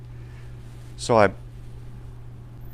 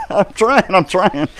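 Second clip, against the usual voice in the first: 1 mock pleading yell; 10 semitones higher, 10 dB louder, slower than usual. Answering the screaming farmer.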